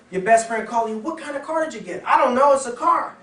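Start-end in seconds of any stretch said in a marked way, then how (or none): 1.0-2.0 curious friend. Acted. Best friend asks about car. 2.1-3.2 high, loud, blank "I don't know"; high, loud, clueless answer to a best friend; clueless buyer. Acted.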